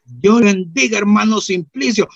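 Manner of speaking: loud, high, comic blessing; church brother's greeting. Retold or imitated. Brother with bad mouth greets Simplicio, loud blessing. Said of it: "llega hermano que le llenía la boca".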